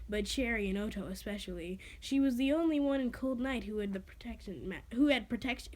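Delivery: plain reading voice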